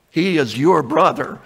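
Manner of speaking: usual speaking voice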